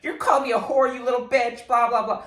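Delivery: high, loud tirade at the dogs; mock scream. Owner telling off the dogs, mock scream. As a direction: rant at your pets as if they insulted you. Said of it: "I scream at my dogs".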